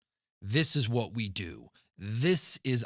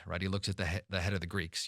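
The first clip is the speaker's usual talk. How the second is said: ordinary talk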